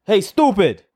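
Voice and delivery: put-on loud, high Puerto Rican accent. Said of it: "I'm gonna do an accent".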